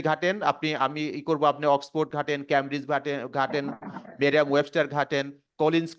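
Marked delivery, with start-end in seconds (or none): none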